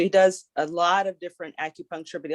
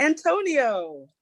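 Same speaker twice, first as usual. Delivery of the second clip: calling out; 7 semitones higher, much slower than usual. Pretend. Answering a pretend phone call.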